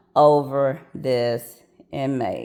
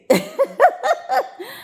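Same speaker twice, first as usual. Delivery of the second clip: high burst of real laughter. Real, not acted. Narrator laughs before telling the big part, real laughter. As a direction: laugh out at the wild turn.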